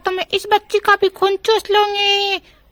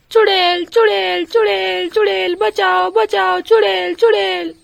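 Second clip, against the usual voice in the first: terrified cries. Acted. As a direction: shriek for help in panic. Sheela wakes screaming witch.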